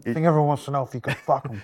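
quick hushed words behind a hand; fast muffled whisper; hand over mouth. Real, not acted. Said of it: "put your hand over your mouth".

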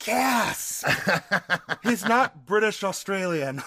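high, laughing mock astonishment; amazed onlookers. Host imagines people hearing the real voice, mock amazement. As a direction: imitate a crowd's delighted gasp of discovery. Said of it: "people are gonna be like".

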